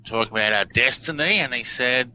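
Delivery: usual speaking voice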